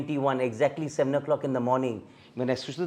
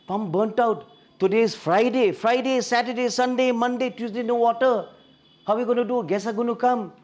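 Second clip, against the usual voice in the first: angry outburst; 8 semitones higher than usual. Retold or imitated. A husband yelling at his wife over the broken pump, angry and high. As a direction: rant at the disaster, pitched up. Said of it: "And I was angry".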